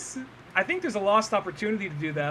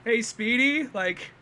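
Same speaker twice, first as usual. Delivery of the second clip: higher, mock-pleading phone call; lightly voiced cartoon caller; 8 semitones higher, 3 dB louder than usual. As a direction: imitate a cartoon character asking a favour. Acts duck phoning from jail.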